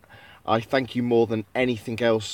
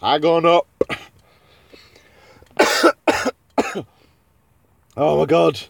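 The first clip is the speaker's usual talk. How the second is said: usual speaking voice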